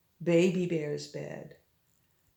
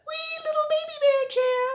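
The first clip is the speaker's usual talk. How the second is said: very high squeaky voice; squeaky tiny voice. A narrator's reading. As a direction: make it sound tiny. Tiny chair for baby.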